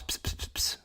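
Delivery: breathy unvoiced ps imitating mic pops; mock the popping plosives into the mic. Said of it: "You're doing a lot of ps".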